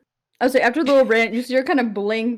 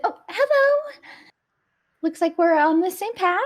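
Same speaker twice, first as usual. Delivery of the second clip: cheerful greeting; 8 semitones higher than usual. Acted. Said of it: "He's like".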